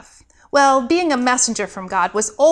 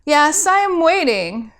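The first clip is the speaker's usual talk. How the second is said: higher, pointed impatience; mildly impatient; 5 semitones higher, 3 dB louder than usual. Acted. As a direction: tap the foot with your voice. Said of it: "Gabriel was not happy".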